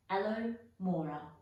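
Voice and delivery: plain reading voice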